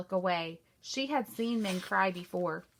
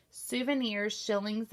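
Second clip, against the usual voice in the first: ordinary delivery, nothing marked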